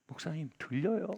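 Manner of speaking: ordinary delivery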